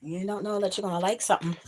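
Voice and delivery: ordinary delivery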